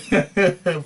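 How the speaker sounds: short amused laugh; a quick burst of laughter. Real, not acted. Host laughs at own story.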